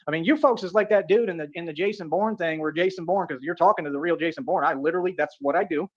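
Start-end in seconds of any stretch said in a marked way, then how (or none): none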